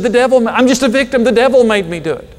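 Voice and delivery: high, loud mock victim whine; whining Eve. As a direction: plead innocence like a sulky child. Eve blames devil, whiny.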